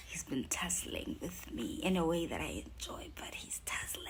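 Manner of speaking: hushed, breathy whisper; confiding whisper. Real, not acted. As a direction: whisper it like a secret confession. Confiding Satan's tussling, dropped to whisper.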